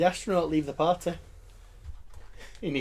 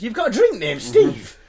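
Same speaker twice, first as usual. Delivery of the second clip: puzzled grasshopper voice; 7 semitones higher, 6 dB louder than usual. Acted.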